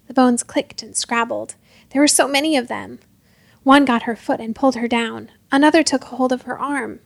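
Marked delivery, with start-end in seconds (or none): none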